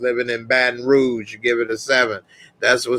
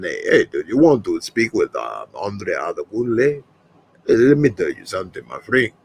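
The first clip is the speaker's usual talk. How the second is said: lower, put-on African accent, a friendly tax helper; an African accent, lower and salesman-like; African accent impression; 4 semitones lower than usual. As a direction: sell tax help in a hammed-up African accent. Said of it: "How you like that impression?".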